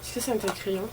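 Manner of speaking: ordinary talk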